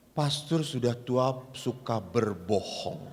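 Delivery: low, slow, quiet mock accusation; teasing accusation. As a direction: lean in and tease him slowly. Teasing the old priest.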